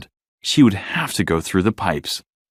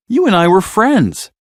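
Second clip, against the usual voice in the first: lightly voiced friendly Destiny, higher; a hint of a bubbly young whale shark; eager old friend, lifted voice; cheerful whale shark; 9 semitones higher, 4 dB louder than usual. A narrator's reading.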